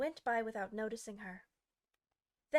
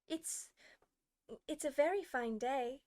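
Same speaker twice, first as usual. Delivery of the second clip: timid; 3 semitones higher than usual. Acted. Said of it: "said a timid voice".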